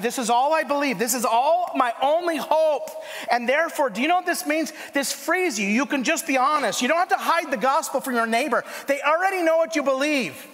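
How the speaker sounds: high and loud, urgent build to the congregation; preaching at full force. Acted. Pastor preaching to his church, urgent and loud. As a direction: preach it out, rising and pressing.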